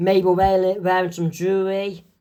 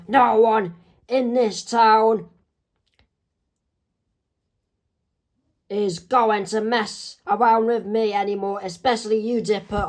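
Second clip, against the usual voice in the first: mild yelled threat; 4 semitones higher than usual. Acted. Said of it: "he yells out".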